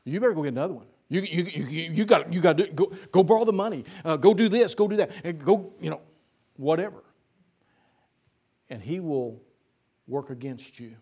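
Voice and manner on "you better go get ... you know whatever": pushy tempter, louder and stammering with urgency; a hint of a nagging devil pressing you to borrow; Satan's nagging voice. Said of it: "Satan will say this".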